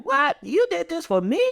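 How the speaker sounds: over-the-top grateful disbelief; amazed surprise. Retold or imitated. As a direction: gasp it out like a thrilled child. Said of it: "it's like".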